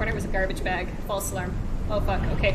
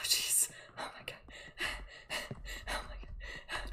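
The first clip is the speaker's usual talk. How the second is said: breathless frightened whisper; scared whisper; 17 dB quieter than usual. Real, not acted. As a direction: whisper in terror. Creeping monster close by.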